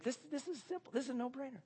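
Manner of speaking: high, soft, self-satisfied musing; smug inner thought. Retold or imitated. Ahab's smug thought, high and hushed. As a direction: Ahab sure he can't lose, voiced high and soft. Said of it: "Ahab's gotta be thinking".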